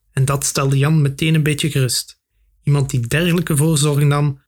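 usual speaking voice

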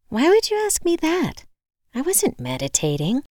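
high-pitched defensive question, then settling; indignant retort. A narrator's reading. Woman answers the locksmith's meditation question, retorting. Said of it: "I retorted".

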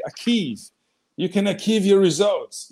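achieve as "akiv" with a hard K; mispronouncing achieve as "akiv"; wrong achieve. Retold or imitated. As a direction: say achieve as "akiv", Italian-style.

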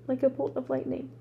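ordinary delivery

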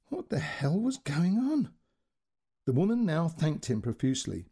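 usual speaking voice

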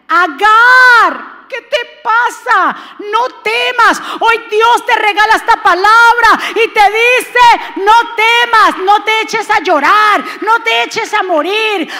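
calling out Agar's name, then pleading firmly; full-throated preacher's cry to a despairing woman; loud sermon call to rise; preaching at full force. Acted. Voices God calling Agar.

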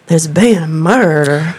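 slow, drawn-out Southern murder-mystery line; mock Southern accent. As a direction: imitate a hammy Savannah accent. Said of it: "No, you have to use the accent".

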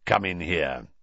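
low, gruff command; a grizzled wizard's growl; gruff old auror. A narrator's reading. Moody voiced in story.

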